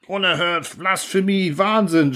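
high, indignant crowd voice; outraged cries. Acted. The council shouts down the narrator, high and outraged. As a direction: cry out in scandalised horror. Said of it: "schallt es durcheinander".

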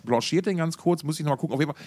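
normal talking voice

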